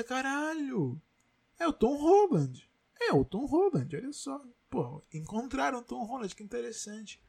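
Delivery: voz aguda de quem reage maravilhado; espanto encenado. Acted. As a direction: reaja com espanto, subindo bem o tom. Reação encenada ao rever a cena. (espanto encenado, em voz aguda)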